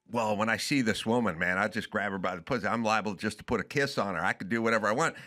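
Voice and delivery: Trump impression. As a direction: swagger it out low and quick. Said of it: "because he says".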